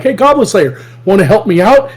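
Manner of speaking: lightly acted request for help; guild member. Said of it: "they'll be like".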